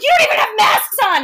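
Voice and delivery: high, loud exasperated scolding; scolding the kids. Retold or imitated. Aunt scolding kids who ran off, loud and high. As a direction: tear into them, loud and high.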